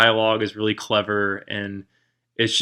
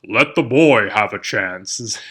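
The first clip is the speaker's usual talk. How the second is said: deep knight voice; 4 dB louder, slower than usual. Retold or imitated. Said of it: "this great knight who has this deep voice".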